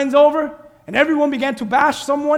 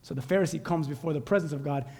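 usual speaking voice

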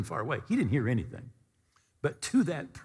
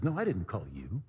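low, soft denial; quiet, puzzled priest; subdued denial; 6 semitones lower, 5 dB quieter than usual. Acted. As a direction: answer softly, pitched down. Priest denies calling a second time.

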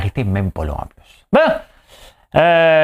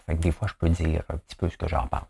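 ordinary talk, nothing marked

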